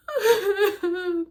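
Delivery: loud, high hammed-up sob; a quick fake sob into the bucket; mock sob laugh. Crying in her bucket, a loud high mock sob. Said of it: "crying in my bucket".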